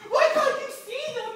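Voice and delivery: high, loud desperate cry; dismayed cry. Acted. Boy cries out to family in dismay. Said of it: "cried Ralph in dismay".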